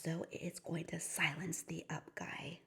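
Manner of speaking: very soft secretive whisper. Shown as a demonstration. Teacher whispering the silencing rule to kids. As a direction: confide the rule in a hush.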